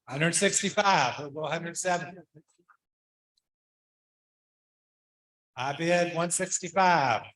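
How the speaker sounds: brisk auction bid calling; auctioneer. Acted. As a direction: call the bids like an auctioneer. Calls bids at auction.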